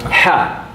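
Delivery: voiceless "Ha" breathed from the throat; breathy throat ha. Shown as a demonstration. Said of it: "Here's my ha".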